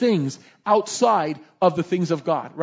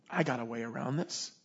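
low, hushed scheming; soft and low, as a plan dawns; thinking aloud; 7 semitones lower, 11 dB quieter than usual. Acted. Prophet finding a loophole for himself, quietly scheming. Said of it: "So he starts thinking".

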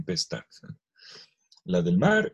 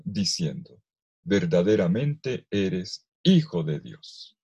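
measured, lifted reading of the Gospel; solemn scripture reading; 3 dB louder than usual. Acted. Preacher reading Matthew to the congregation, solemn proclamation. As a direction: proclaim the passage to the congregation with weight. Reads Gospel passage aloud.

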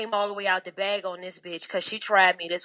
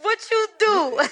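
squeaky high parroting of the question, much higher; mock high-pitched echo; 13 semitones higher, 7 dB louder than usual. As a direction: echo it back in a high teasing voice.

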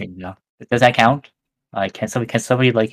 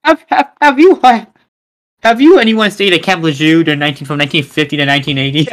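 infomercial; 3 semitones higher, 4 dB louder than usual. Pretend. Mock legal ad, Camp Lejeune.

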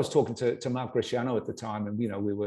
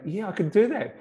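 eager reply; 9 semitones higher, 3 dB louder than usual. Retold or imitated. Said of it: "And I'm going".

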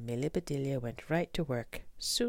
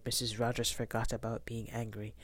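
usual speaking voice

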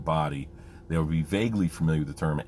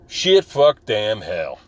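loud burst of cursing, imitated; stressed-out outburst; 4 semitones higher, 10 dB louder, much slower than usual. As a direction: fire it off hard, starting high then dropping. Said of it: "If he was stressed out".

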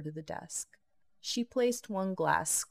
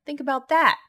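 excited exclamation; 10 semitones higher, 13 dB louder than usual. A narrator's reading. Jade exclaims in disbelief.

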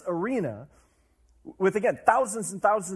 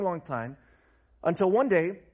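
usual speaking voice

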